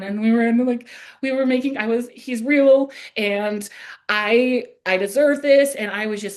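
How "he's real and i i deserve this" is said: higher, louder panicked blurt; retold panic. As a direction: blurt it out in a rush of mock panic. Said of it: "having this little panic moment".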